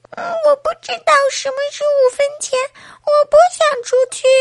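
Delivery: put-on high, fast baby whine; crying baby. A narrator's reading. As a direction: wail quickly in a baby voice. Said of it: "宝宝又哭了".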